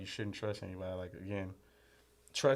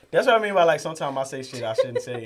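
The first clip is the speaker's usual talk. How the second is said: laughing confession; 4 semitones higher, 12 dB louder than usual. Real, not acted.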